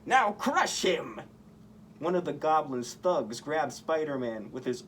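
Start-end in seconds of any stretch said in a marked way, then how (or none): 0.1-1.2 slow, higher barked order in the Goblin voice; villain command. A narrator's reading. Goblin ordering his thug, slow villain command. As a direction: order the thug to crush him, savoring it.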